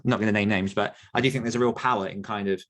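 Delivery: ordinary delivery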